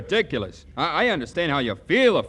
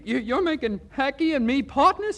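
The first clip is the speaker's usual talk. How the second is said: higher, disbelieving; can't believe the offer; stunned pal checks what he heard; astonished; 9 semitones higher than usual. Acted.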